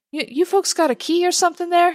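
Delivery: high, quick prying voice; nosy busybody. Acted. Babs pushing her way in on the group, high nosy prying. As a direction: butt in with a high, hurried question.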